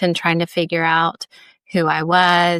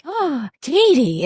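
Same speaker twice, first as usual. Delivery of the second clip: higher, slow mock-chiding "Oh, Katie"; mildly tut-tutting like a mom; mom's gentle scold; 8 semitones higher, slower than usual. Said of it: "she might be like".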